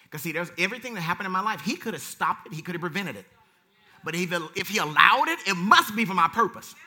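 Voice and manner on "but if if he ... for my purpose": preaching to the congregation; rising preaching. Real, not acted. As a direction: preach it with fire. Preaching at full force.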